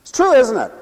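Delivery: ordinary talk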